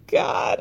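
breathy pained cry; unvoiced cry. Real, not acted. Feeling bad for Mark, a breathy pained cry. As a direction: cry out the word in cringe. Cringing at the scene.

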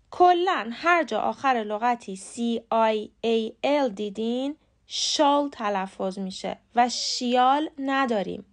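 ordinary talk, nothing marked

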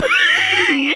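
loud-edged high laugh; burst of laughter. Real, not acted. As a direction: crack up at the joke. Laughs at host's joke.

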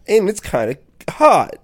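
loud teasing yelp. Real, not acted.

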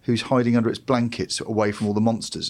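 ordinary talk

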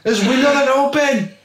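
high, laughing self-imitation of the struggle; laughing mock frustration. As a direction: play your younger self fumbling with the plane window. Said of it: "It's like".

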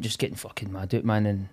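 usual speaking voice

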